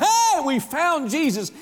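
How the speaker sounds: high, slow, joyful call of discovery; excited shout. Retold or imitated. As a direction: shout it like you just found him. Philip caught up in excitement.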